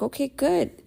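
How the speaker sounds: high-pitched, emotional reply; emotional relief. Retold or imitated. Mother excited by her ex's news, emotional relief. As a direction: let the pitch leap up with emotion. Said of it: "I get emotional".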